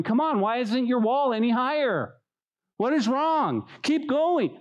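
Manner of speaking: high, pushy, nagging critic; scolding bystander, pitched high; impatient critic. Retold or imitated. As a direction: badger them to hurry up. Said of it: "the guy who's on the other side going".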